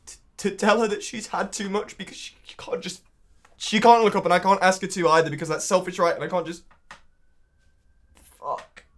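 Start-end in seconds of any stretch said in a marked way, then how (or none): 0.0-6.6 high, loud, faltering outpouring; desperate outburst. Acted. Man unable to tell her enough, desperate outburst. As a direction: burst out high, then stumble over the words. Breaks down over failing her.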